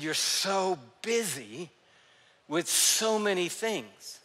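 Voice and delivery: slow, soft and tender, drawing each word out; gentle rebuke. Retold or imitated. Jesus to busy Martha: slow, soft tender rebuke. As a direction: ease her down kindly, no scolding.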